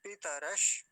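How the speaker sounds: पितरश stopped right on the sh, no vowel; half sha. Shown as a demonstration. Teacher showing the half letter, stopping on sh. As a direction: halt on the final sh of पितरश. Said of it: "आधा है तो आधा ही बोलेंगे".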